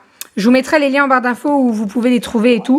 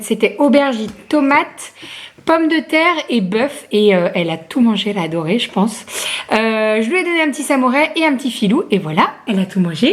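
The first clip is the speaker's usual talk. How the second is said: normal talking voice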